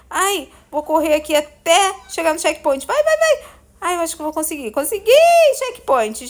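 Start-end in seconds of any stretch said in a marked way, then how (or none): 2.8-3.4 high urgent urging; being chased. Real, not acted. Ele tá atrás de mim.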